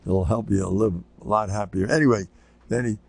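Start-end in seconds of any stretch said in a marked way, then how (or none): none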